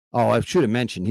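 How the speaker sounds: ordinary delivery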